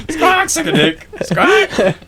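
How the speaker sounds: high parrot squawk, cracking up; laughing parrot voice. Retold or imitated. Parrot impression through laughter.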